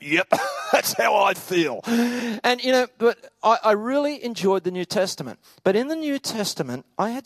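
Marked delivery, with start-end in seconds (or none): 0.0-1.8 wry laugh. Retold or imitated. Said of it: "I shut the book and I went".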